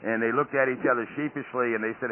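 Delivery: ordinary delivery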